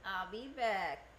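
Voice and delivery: low, slow tough-guy growl; deep action-hero growl on the catchphrase; movie catchphrase. Pretend. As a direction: drop into a Terminator-style sign-off.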